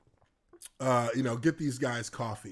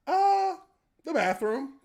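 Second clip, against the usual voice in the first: much higher, timid reply; sheepish answer; 12 semitones higher, 5 dB louder than usual. Retold or imitated. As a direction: admit it meekly. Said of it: "I said".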